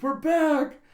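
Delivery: high, loud gleeful shout; excited child. Acted. Girl announcing she's home, excited high shout. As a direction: burst in calling out happily. Anya bursting through the door.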